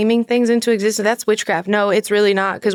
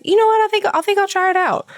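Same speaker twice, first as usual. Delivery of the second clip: much higher, quickening voice; retold eager agreement; 11 semitones higher, faster than usual. Wife quoting her husband agreeing to come along, high and eager. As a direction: imitate someone brightly deciding to join.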